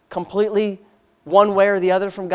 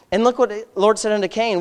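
normal talking voice

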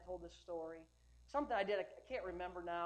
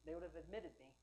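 ordinary talk, nothing marked

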